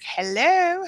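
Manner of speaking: a sudden high-pitched happy hello; squealed hello. Real, not acted. Greeting returning viewer Beg and Bark.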